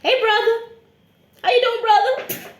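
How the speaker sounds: high, loud forced friendliness; nervous greeting. Retold or imitated. Passenger overacting a warm hello to a stranger, high and loud. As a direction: greet him like family, nervously.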